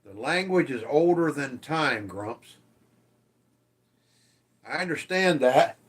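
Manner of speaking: normal talking voice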